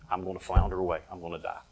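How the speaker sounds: low, fading voice of defeat; hammed-up quiet despair; defeated murmur. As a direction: give up, sinking to almost nothing. Voicing an intimidated, defeated believer.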